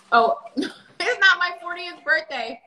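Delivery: ordinary talk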